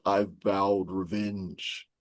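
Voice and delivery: soft, slow, menacing hush; ominous vow. Acted. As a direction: let the vow of revenge sink low and slow. Reading Poe's story aloud.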